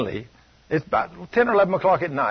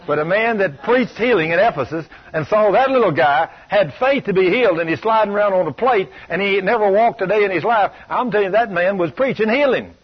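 louder, driving preacher cadence; preaching at full force; 4 semitones higher, 4 dB louder than usual. Acted. Preacher rousing the church about Paul, loud and driving. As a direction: hammer it home to the congregation.

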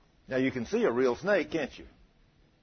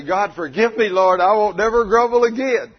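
loud, raised cry to the Lord; loud pleading repentance; 5 semitones higher, 8 dB louder than usual. Acted. Preacher voices the girl begging God's forgiveness, loud pleading. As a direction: plead it out, building louder and higher to the end. Models a repentant prayer for listener.

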